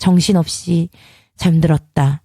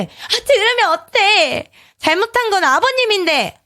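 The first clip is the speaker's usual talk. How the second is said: defiant retort; 13 semitones higher than usual. A narrator's reading.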